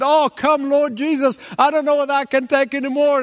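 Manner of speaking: venting in desperation at the news; exasperated plea. Retold or imitated. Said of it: "I said".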